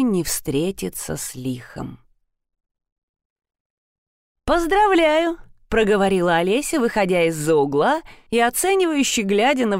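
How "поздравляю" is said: bright congratulation. Acted. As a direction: call it out brightly, high. Witch greets with loud congratulation.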